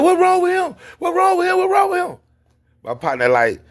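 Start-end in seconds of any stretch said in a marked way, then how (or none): 0.0-2.2 very high, loud panicked shriek; alarmed wife. Retold or imitated. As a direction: imitate a frantic wife, pitched way up. Acts wife running up in alarm.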